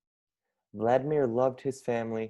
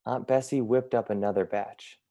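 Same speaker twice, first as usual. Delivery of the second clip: usual speaking voice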